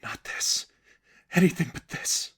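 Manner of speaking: breathless plea, whisper rising into a cry; begging God under her breath; hushed pleading prayer; desperate whispered prayer. A narrator's reading. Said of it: "she began to pray".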